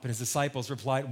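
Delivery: normal talking voice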